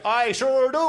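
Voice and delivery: loud, high, happy call back; answering the ex-wife across the water; cheerful shout. Retold or imitated. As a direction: yell back brightly, clueless. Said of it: "I said".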